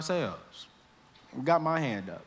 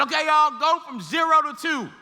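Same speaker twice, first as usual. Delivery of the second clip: teacher calling out louder and higher; loud instruction; 9 semitones higher, 4 dB louder than usual. Retold or imitated. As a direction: call the step out across the lab.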